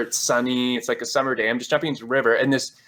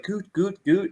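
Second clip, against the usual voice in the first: unsure German reply; 8 semitones higher, slower than usual. Retold or imitated. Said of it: "I'm just like".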